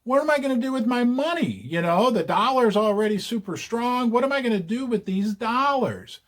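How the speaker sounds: high, fast panicky investor voice; mock-frantic voice of a stuck investor; worried investor. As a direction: play the investor wringing his hands. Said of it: "be like".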